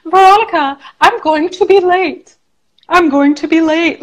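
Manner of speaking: student. Retold or imitated. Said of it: "it's a student saying".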